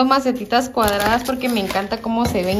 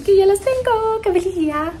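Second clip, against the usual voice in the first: happy squeal; 9 semitones higher than usual. Real, not acted. Finally found the plants she wanted.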